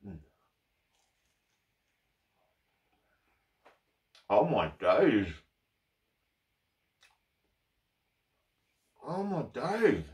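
speaking between bites; chewing while talking. Real, not acted. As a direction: mouth partly full of toast. Seen 0: Holds toastie at mouth after bites, jaw working while speaking.

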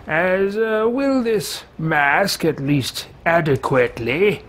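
slow, haughty appraising tone; pompous character voice. Pretend. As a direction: inspect loot like a snob. Loots body in character voice.

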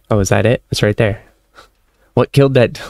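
normal talking voice

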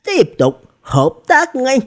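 high, slow, solemn command. A narrator's reading. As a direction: give a serious order in a high, drawn-out voice. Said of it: "Sa Bà Bà nghiêm túc nói". (giọng cao, chậm, nghiêm túc)

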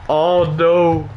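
high, pained "oh no"; anguished groan. Real, not acted. Gamer groaning as Liverpool score late, anguished. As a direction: cry out at the late goal.

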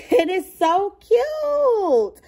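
high, slow, laughing delight; slow giggly gushing. Real, not acted. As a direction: gush over the bag through a laugh. Laughing, gushing over bag.

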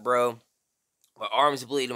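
plain reading voice

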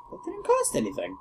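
surprised. Real, not acted. Surprised at free item.

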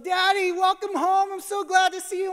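put-on high, loud, thrilled child greeting; excited little daughter. Daughter greeting dad at the door, high excited child voice. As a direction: squeal it like a toddler happy to see Dad. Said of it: "she's like".

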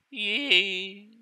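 usual speaking voice

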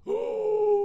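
high, loud drawn gasp; shocked gasp. Real, not acted. Player hears the witch offer motherhood, shocked gasp. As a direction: gasp loudly in surprise. Reacts to witch saying Mom.

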